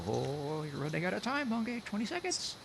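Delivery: very high, put-on singsong warning; mock-urgent taunt. Host hurries guest on a countdown, teasingly high-pitched. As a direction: tease with a squeaky rising countdown.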